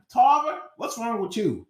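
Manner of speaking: a teacher shocked at his student; alarmed teacher. Retold or imitated.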